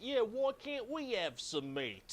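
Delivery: high, slow Cockney orc voice. Acted. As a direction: play a hungry orc with a drawn-out Cockney accent. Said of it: "Do the orcs all have Cockney accents?".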